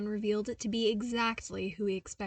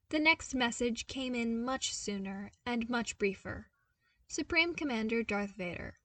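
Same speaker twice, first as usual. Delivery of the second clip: usual speaking voice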